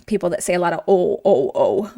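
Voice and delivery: o, o dropped low like a country bumpkin; mock-rustic deep o, o; mock oh-ing. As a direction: imitate rustic o, o sounds low. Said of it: "places that do оконья".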